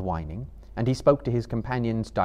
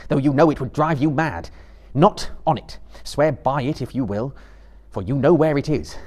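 raised, commanding Frodo; stern pity; 5 semitones higher, 6 dB louder than usual. A narrator's reading. Frodo confronting Gollum about the ring, stern pity. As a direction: speak down to him with stern pity. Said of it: "with stern pity".

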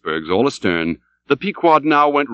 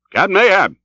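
calling out; 8 semitones higher, 4 dB louder than usual. Acted. Tashtego calls to his captain.

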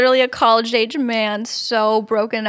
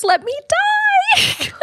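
high, very slow mock-wailing whine; melodramatic whine; much slower than usual. Mocking the boyfriend's texts to his girlfriend, high drawn-out wail. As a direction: ham up a dying boyfriend's wail.

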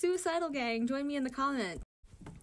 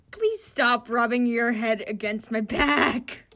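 drawn-out loud protest; loud slow complaint; 11 dB louder, slower than usual. Acted. Annoyed player telling a clingy teammate off, loudly and slowly. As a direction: tell him off loudly and slowly. Comic character complains about rubbing.

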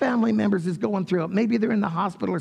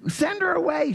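annoyed disciples; 10 semitones higher than usual. Acted. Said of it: "the disciples came and besought him".

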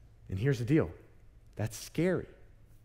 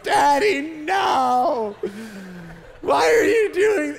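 high, loud panicked wail; imitated screaming child; 10 semitones higher, 7 dB louder, much slower than usual. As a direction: shriek at Daddy like a terrified little kid, hammed up. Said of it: "he just starts screaming and screaming".

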